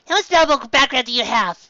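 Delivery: put-on high young reindeer doctor, earnest; high, brisk little doctor voice; Chopper. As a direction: give orders as a small, serious doctor.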